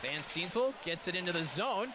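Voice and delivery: fast play-by-play rising to a goal call; rapid hockey play-by-play. Acted. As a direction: call the rush, then hit the goal.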